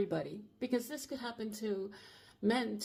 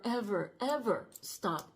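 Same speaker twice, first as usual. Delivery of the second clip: ordinary talk, nothing marked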